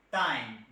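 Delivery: "time" with a hard retroflex Indian t. Shown as a demonstration. Trainer shows the Indian way, retroflex t. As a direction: say "time" the Indian way, tongue curled back. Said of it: "we Indian".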